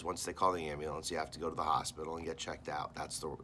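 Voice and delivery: ordinary delivery, nothing marked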